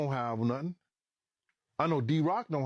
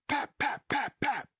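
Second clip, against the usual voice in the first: high, evenly spaced vocal pops; imitated gunfire; 11 semitones higher, slower than usual. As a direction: mimic shots several cars away. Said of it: "five or six cars were like".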